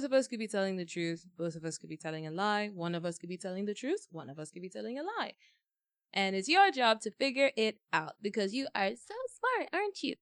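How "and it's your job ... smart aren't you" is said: condescending. Acted. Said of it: "you condescending the audience".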